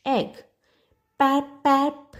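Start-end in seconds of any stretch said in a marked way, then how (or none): 1.2-2.2 a higher, blunt pap pap; honky chick call. Acted. Narrator voices the strange hatchling's first pap pap. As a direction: squawk it like an odd baby bird. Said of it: "it said, not "peep, peep".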